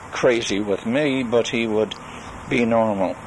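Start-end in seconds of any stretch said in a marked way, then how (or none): none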